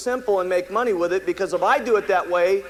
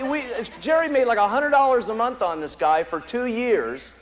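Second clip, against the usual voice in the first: ordinary delivery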